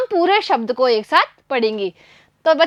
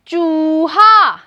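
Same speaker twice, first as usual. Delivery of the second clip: चूहा drawn out very slowly; slow model word; much slower than usual. Shown as a demonstration. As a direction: stretch चूहा out for the class. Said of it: "मेरे साथ साथ बोलने का अभ्यास". (चूहा बहुत धीरे खींचकर)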